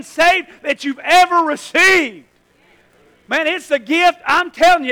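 loud, high, driving sermon voice; full-force preaching. Real, not acted. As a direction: preach it out to the congregation.

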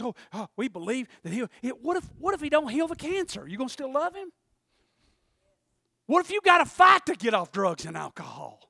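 ordinary talk

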